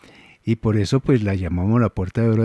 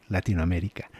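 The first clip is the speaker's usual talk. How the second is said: softer, trailing repeat; quiet echo; 5 semitones lower, 8 dB quieter than usual. Retold or imitated. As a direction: say it again under your breath. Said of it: "después uno dice".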